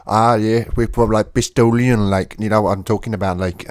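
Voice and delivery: loud Bristolian impression; Bristol accent. Shown as a demonstration. Londoner imitating the locals in Bristol, Bristol accent. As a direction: put on a broad Bristol accent. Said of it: "they talk like this".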